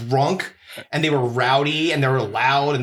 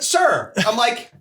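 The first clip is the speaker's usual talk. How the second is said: confronting; 8 semitones higher, slower than usual. Retold or imitated. Comic confronts man from the mic.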